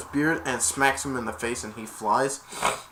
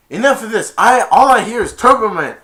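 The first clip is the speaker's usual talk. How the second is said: loud, raised mock outburst; angry yelling; 8 semitones higher, 13 dB louder than usual. Dad yelling at his son on the phone, exasperated. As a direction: yell it in exasperation. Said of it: "he was yelling about it".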